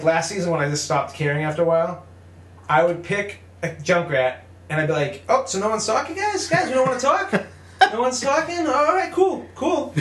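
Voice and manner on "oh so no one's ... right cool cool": much higher, put-on friendly voice; mock-innocent goading. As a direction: needle silent teammates with fake cheer. Retold goading of silent teammates.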